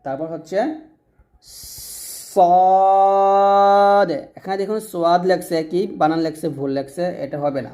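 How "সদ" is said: সদ with a long hissed s and a held, rounded vowel; drawn-out letter demo. Shown as a demonstration. Teacher has pupils repeat after him, সদ slow and sustained. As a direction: stretch সদ out at length for pupils to copy. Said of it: "এরকম আমার সাথে বলেন".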